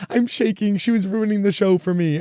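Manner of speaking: put-on high, loud, rattled girl's voice; shaken fan girl. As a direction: squeak it out like a shaken fan in the front row. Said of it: "the girl next to her was like".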